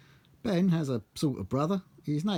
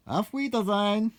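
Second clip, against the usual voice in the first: German goodbye; 8 semitones higher, 3 dB louder than usual. Acted.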